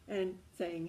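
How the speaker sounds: ordinary talk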